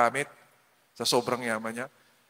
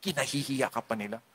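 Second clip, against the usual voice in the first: ordinary talk, nothing marked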